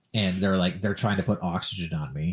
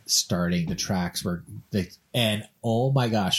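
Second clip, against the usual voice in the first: ordinary talk, nothing marked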